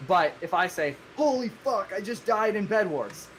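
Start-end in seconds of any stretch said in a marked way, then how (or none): 1.1-3.3 high-pitched put-on outburst; acted-out cry of dismay, pitched up; mock frustrated exclamation. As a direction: exclaim it like a gamer who just lost. Acts out example gamer outburst.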